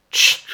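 sudden explosive mock sneeze; sharp storybook sneeze noise; loud sneeze. A narrator's reading. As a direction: burst out a loud "tchit" sneeze. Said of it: "éternua quelqu'un si fort".

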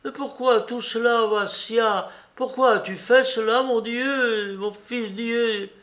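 put-on high, pleading voice of an old mother; an old mother begging her son to explain; old mother's plea. A narrator's reading. Old mother pleading with her condemned son, high and anguished.

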